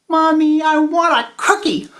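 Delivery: put-on high screaming child. Child screaming back after mommy says no. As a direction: scream it again at full volume.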